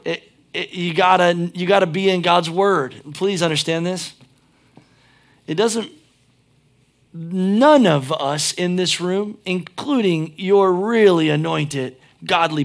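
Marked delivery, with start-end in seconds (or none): none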